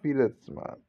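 ordinary delivery

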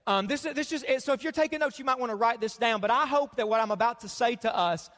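normal talking voice

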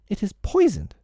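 high little fairy voice; fairy warning. A narrator's reading. Fairy warning Peter about his medicine, high urgent voice. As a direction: pitch it high, falling at the end.

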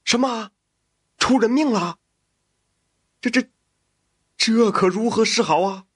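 high-pitched, stammering alarm; shocked. A narrator's reading. Master hearing of a death caused by his son, shocked alarm. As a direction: jolt upward in shock, then falter. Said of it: "王员外惊的是跳了起来".

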